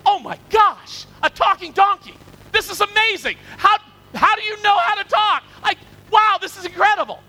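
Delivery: hammed-up astonishment, high and loud; mock amazement. Women imagined meeting a talking donkey, hammed-up amazed shouting. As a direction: gush in exaggerated wonder, pitch way up. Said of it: "you would say".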